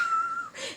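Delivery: high, brief laugh breaking out; real squeal of laughter. Real, not acted. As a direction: let a quick high laugh escape. Laughs at what she expected.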